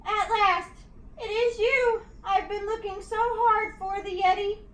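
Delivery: triumphant relief spilling out; thrilled boy. A narrator's reading. George thinking he finally found the Yeti, thrilled.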